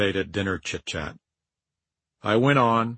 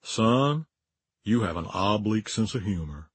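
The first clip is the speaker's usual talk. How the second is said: low Mississippi drawl on the line; 3 semitones lower, 5 dB quieter than usual. Retold or imitated. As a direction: ease into a soft Southern Mississippi drawl. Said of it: "said in his beautiful Mississippi drawl".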